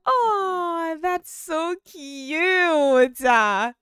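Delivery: high, loud, stretched-out cooing; gushing scream. Real, not acted. Gamer reacting to her Sims being so cute, screaming. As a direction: scream it slowly, melting over the cuteness. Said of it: "I'm screaming in y'all ears".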